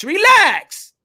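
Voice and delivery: high, drawn-out teasing relax; sing-song mock calming relax; mock soothing relax. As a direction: croon it up high to calm him down. Host mockingly soothes heated panelist.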